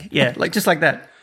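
ordinary talk

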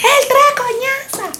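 high yelled retort; exasperated shout. Retold or imitated. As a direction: snap at her, fed up. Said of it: "Y yo".

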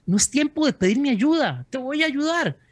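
a preacher lifting his voice high to speak as God; voicing God. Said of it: "lo que le estaba diciendo Dios a Moisés es".

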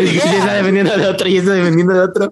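high, fast, laughing tease; laughing taunt. Real, not acted. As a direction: gloat through laughter. Teasing rival over his slip.

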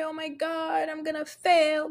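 hammed-up tearful panic; mock crying. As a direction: imitate a student despairing over a bad grade. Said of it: "you're crying about it".

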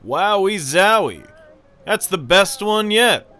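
high, loud, drawn-out announcer delivery; announcer outro. Acted. Announcer signing off the episode and crediting cast, showy announcer voice. Show outro read over music.

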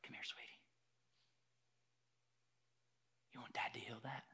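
very soft, intimate whisper; tender fatherly whisper. Acted. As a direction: whisper gently like a father comforting his child. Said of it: "It's much more like this".

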